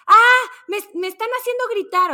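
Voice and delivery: high, loud frantic cry; panicked pilot yell. Retold or imitated. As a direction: scream it like a pilot losing it. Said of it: "el piloto por el micrófono". (piloto desesperado gritando)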